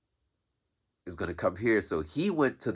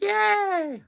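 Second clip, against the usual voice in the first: much higher, louder mock cheer; kids cheering; 6 dB louder than usual. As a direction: squeal like happy kids.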